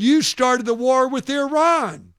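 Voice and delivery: loud, high, slow mock outburst; retold angry accusation. Retold heckler accusing the speaker.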